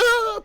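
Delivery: short, very high, loud scream; mock panicked scream. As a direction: yelp like frightened kids. Imitates kids screaming at bee.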